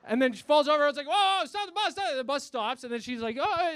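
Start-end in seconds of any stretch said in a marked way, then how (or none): 1.1-2.1 high, loud mock alarm; alarmed calling out. Passengers when old lady falls, alarmed shout. 3.4-3.7 put-on a little old lady's pitched-up groan. Old lady getting back up, high mock groan. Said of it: "is an old lady".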